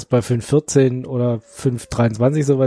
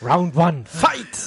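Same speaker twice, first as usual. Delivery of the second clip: booming fighting game announcer; much slower than usual. Pretend. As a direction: hype up the round start. Kernels fight like Battle Royale.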